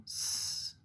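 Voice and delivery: long sustained hiss for "S"; pure held sss on "S"; isolated S sound. Shown as a demonstration. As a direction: say "S" as a drawn-out sss, not the letter name. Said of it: "va faire le son S".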